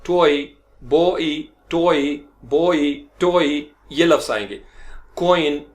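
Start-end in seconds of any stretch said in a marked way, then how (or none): 0.1-2.9 टॉय and बॉय slow, o gliding into i; diphthong drill. Shown as a demonstration. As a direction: stretch टॉय बॉय for the class. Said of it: "ओई, बॉय". (धीमे धीमे टॉय बॉय)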